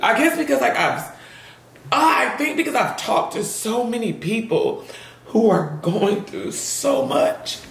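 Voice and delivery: high voice, fighting back real tears; tearful. Real, not acted. Explaining that so many people are going through so much, tearful. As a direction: push the words out through rising tears. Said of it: "getting teary-eyed".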